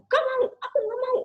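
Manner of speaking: high, loud tantrum whine; whining child. Retold or imitated. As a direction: throw a tantrum like a small child. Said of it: "pas teriak-teriak".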